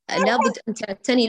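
ordinary talk

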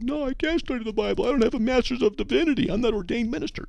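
high-pitched mock protest; mock doubter. Objection of doubtful people, voiced.

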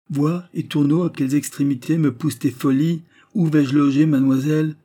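voix de vieillard bourru qui s'échauffe, plus haute et plus forte; le vieux qui éclate et prend les choses en main; vieux bonhomme emporté. A narrator's reading. Noël reproche à Antoine. (vieillard bourru qui s'emporte, plus fort)

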